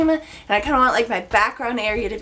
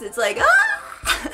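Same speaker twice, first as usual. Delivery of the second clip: high squeal; much slower than usual. Real, not acted. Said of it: "my voice is all over the place".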